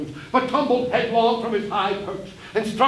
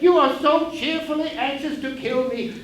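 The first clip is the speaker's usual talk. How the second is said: ordinary delivery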